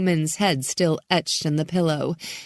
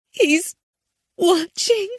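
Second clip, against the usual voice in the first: frightened; 13 semitones higher, 3 dB louder, slower than usual. A narrator's reading. Frightened girl on phone.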